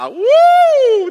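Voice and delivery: a high, loud, joyful woo; excited whoop. Real, not acted. Preacher whooping at the congregation, thrilled. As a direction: whoop it out, caught up in the word.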